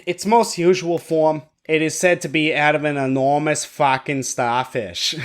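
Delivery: put-on Boston accent; comic attempt at Boston speech. Podcaster reading the bunyip record for co-hosts, Boston accent. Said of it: "do it in a Boston accent".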